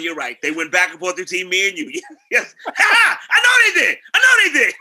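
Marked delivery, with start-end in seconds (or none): 2.3-4.7 high, loud laughing cry; cracking up while shouting it out. Real, not acted. Laughing at the memory.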